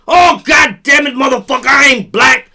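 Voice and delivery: fake-angry shout of denial; hammed-up indignant yell; mock outraged denial. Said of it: "I ain't gonna be like".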